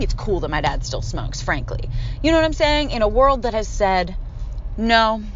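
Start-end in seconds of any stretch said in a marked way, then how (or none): none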